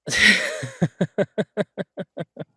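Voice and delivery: loud, high burst of laughter; long rippling laugh, tapering off; hearty laugh. Acted. Chef teasing partner about the bear, hearty laugh.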